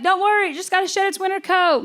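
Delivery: soothing the child with a fib, lightly voiced; mom voice. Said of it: "my mom was like".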